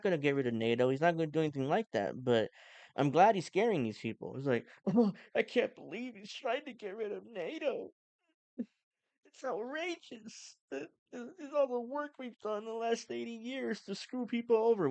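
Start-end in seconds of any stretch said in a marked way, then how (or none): 4.9-14.9 much higher, put-on whiny voice; mock outrage. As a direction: parody a pearl-clutching elitist. Mocking outraged establishment people.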